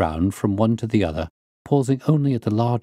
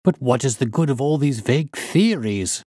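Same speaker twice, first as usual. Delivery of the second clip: higher, exasperated banker; impatient cry; 5 semitones higher than usual. A narrator's reading. Banker objecting to the detective's vague theories, impatient. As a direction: cut in with rising impatience. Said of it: "cried the banker impatiently".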